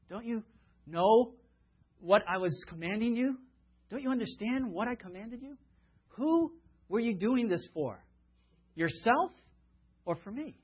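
a teacher let down by his students, asking why; a touch of weary disappointment; exasperated rebuke. Retold or imitated. Jesus sighs, rebukes the disciples.

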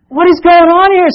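high, loud panicked cry; panicked disciples. Acted. Panicked disciples shouting to Jesus in the storm. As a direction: shout it over the storm in alarm.

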